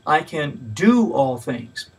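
"do" pushed forward, slow delivery; stress on DO. Shown as a demonstration. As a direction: weigh down on "do". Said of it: "each time placing a different emphasis".

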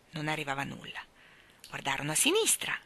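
usual speaking voice

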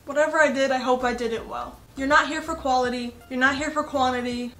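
normal talking voice

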